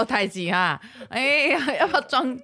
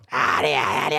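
zombie sound; 9 semitones lower than usual. Retold or imitated. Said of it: "僵尸到底发出什么声音".

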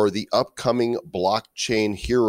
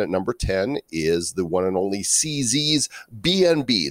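ordinary talk, nothing marked

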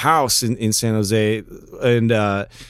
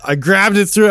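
ordinary talk, nothing marked

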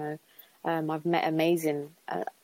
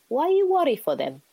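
high, loud imitation of mum's accent, not quite native English; 9 semitones higher, 4 dB louder than usual. Mum soothing stressed family, in her accent. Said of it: "she had a little accent as well".

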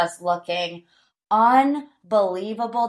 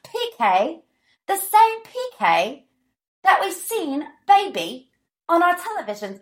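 higher-pitched, badly imitated British accent; horrible Dorit accent; 8 semitones higher than usual. Podcaster aping Dorit about P K on television, horrible British accent. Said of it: "That was a horrible Dorit accent".

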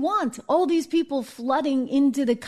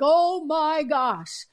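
a slow, drawn-out, higher mock gasp of panic; 5 semitones higher, much slower than usual. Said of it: "They're like".

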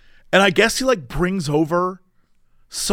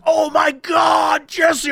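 excited shout; 9 semitones higher, much slower than usual. Retold or imitated. Coworker spotting a streamer, high excited shout. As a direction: shout it like you just spotted a celebrity.

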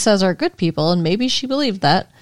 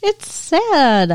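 put-on high, playful Valley girl; 8 semitones higher, 3 dB louder than usual. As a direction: answer back in Valley girl voice. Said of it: "Valley girl".

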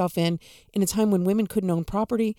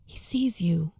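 whispered; 3 semitones lower, slower than usual. Real, not acted. Preacher whispers to the suffering listener, hushed and tender. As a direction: whisper it like a secret comfort.

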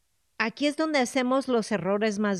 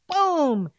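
boom; 5 semitones higher, 5 dB louder than usual. Retold or imitated. Brain slamming shut, sound effect.

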